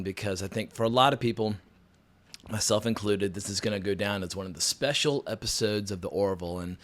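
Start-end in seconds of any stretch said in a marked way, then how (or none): none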